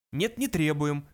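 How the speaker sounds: ordinary delivery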